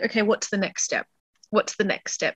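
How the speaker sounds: soft, calm focusing murmur; quiet self-talk. Retold or imitated. Overwhelmed sailor steadying herself, soft quiet self-talk. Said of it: "it brings my focus in of, like".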